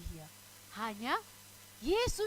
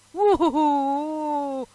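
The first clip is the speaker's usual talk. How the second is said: long, loud spooky "woo"; drawn-out mock ghost howl; ghostly wail; 8 dB louder, much slower than usual. Imitates a ghost after "hantu. (suara hantu melolong panjang)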